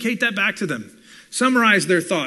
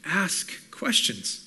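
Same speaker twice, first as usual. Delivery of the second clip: ordinary talk, nothing marked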